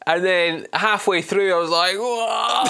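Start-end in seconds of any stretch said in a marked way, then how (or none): 2.0-2.7 long drawn-out, higher wail of "whoa"; slow-motion cry as it all falls apart; mock alarmed whoa. Retelling reaction as accent falls apart.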